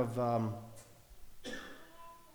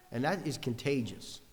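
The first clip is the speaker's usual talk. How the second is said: normal talking voice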